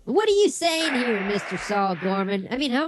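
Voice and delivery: normal talking voice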